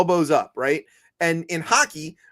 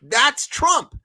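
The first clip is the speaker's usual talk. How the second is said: mock Carney; 7 semitones higher than usual. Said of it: "And Carney is like".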